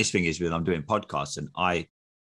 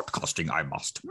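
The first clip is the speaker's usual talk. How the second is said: wise little alien's backwards musing; Yoda; 3 semitones lower than usual. Acted. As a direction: ponder it like a tiny creature sage. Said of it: "you are like Yoda".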